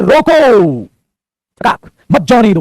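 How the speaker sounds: stretched "रोको", long pause, then "मत जाने दो"; long pause after रोको. Shown as a demonstration. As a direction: hold "रोको" out, stop, finish with "मत जाने दो". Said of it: "बोलते टाइम आपका ठहराव ही पुत्र जी कोमा". ("रोको" खींचकर लंबा ठहराव, फिर "मत जाने दो")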